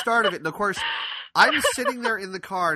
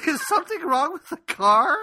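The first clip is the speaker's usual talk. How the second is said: high-pitched, wailing mock dismay; imitated alarmed grandmother; 13 semitones higher, 4 dB louder than usual.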